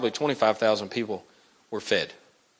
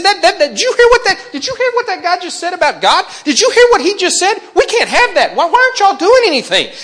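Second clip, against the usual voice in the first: very high, loud squeal of disbelief; a shocked boy who can't believe nobody acts; outraged young David; 7 dB louder than usual. Acted. Young David shrieking at the silent soldiers, very high and loud.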